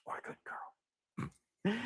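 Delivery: slow playful whispered aside; a whispered little correction. Real, not acted. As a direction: breathe it as a sly afterthought. Afterthought whispered about the dog.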